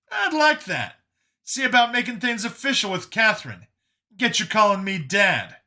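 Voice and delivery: high, animated character voice; pleased, teasing Brian. A narrator's reading. Brian hopes Vincent will call him Dad, teasing. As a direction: tease him in a high, lively voice.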